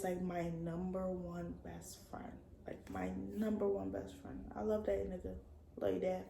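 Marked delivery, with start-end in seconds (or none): 0.0-4.4 whispered, soft and tender. Real, not acted. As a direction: breathe it out like a secret. Repeats it softly about dad.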